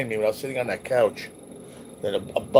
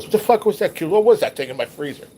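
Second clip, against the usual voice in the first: higher and louder, imitating an angry roommate; retold angry outburst; 6 semitones higher, 5 dB louder than usual. Imitating roommate Danny yelling about the freezer, angry. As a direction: fire off the angry question the way Danny did. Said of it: "and Danny goes".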